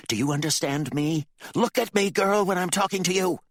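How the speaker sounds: high, loud, furious demand; irate father shouting; enraged threat. A narrator's reading. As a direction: bear down on them, building to a shout. Father's rage flares at daughter.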